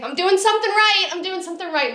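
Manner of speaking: overjoyed mother hearing her son's love; joyful relief. Real, not acted. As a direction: let the joy spill out.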